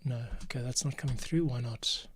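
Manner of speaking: ordinary talk, nothing marked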